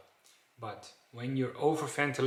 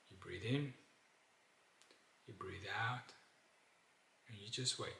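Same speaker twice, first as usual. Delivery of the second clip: much softer, lower and unhurried; soft relaxed breathing; 3 semitones lower, 12 dB quieter than usual. Shown as a demonstration. Guiding listeners into flow breathing, soft and relaxed. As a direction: let the words settle out gently. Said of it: "then you can do the flow breathing".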